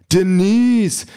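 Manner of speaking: high, loud booming call; loud, falling cry through speakers; Ken over loudspeakers. A narrator's reading. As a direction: project her name loudly, pitched high. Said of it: "Kens Stimme erklingt über die Lautsprecher".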